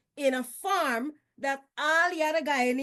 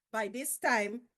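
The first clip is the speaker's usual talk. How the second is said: normal talking voice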